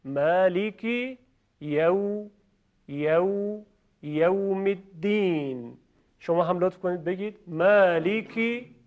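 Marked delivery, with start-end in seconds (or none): 0.0-5.8 very slow «یوم» as yawm; slow drill. Shown as a demonstration. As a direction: break «یوم الدین» apart slowly. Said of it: "شما بینندگان عزیز هم تکرار کنید".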